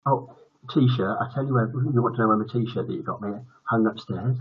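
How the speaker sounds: ordinary delivery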